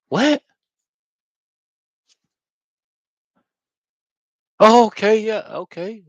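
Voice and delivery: a very high, loud squeak of disbelief; high astonished yelp, then a flustered okay; stunned surprise. Retold or imitated. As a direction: act out being caught off guard, voice jumping way up. Acting his stunned reply to the divine.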